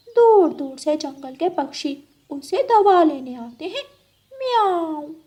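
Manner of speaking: high, sweet-talking cat voice with meows; a scheming cat luring her friend; high purring cat voice. Acted.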